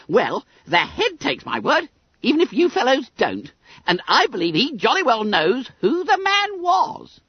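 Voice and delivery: high, squeaky schoolboy voice; self-satisfied Billy Bunter. A narrator's reading. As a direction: pipe it up high, full of importance.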